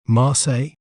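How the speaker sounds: Marseille said as "mar-SAY"; careful pronunciation. Shown as a demonstration. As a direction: model "Marseille" with silent L's. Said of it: "We may pronounce it as".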